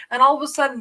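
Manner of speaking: ordinary delivery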